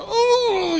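high yelp of hidden pain; a quick ouch you try to hide; mock pained ooh. Wincing to himself, a high pained ooh. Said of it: "where they might look and go".

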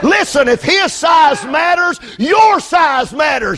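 shouted, rhythmic sermon cadence; full force preaching. Acted. Preacher shouting at the congregation about Goliath, full force preaching. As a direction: hammer each line home to the church.

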